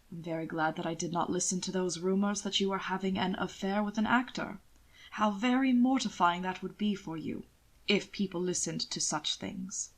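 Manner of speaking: a sardonic gentleman savouring his jab; suave lord, dry. Acted. Hannibal's veiled barb.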